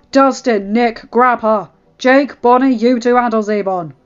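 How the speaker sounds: loud, forceful commands to a team; barked orders. Acted. Derek directing his fighters mid-battle, barking orders. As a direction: fire out orders by name.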